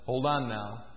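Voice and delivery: low, soft and calm, as God; God gently halting him; lightly voiced God, low and hushed. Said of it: "God says".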